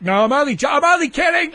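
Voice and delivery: loud, high-pitched teasing protest; loud high joking protest. Acted. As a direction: shout it high and playful. Joking after insulting APRA.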